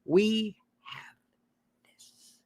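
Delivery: "We have" soft, then "this" whispered; hushed dramatic whisper; whispered ending. Shown as a demonstration. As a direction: drop to a whisper on "this".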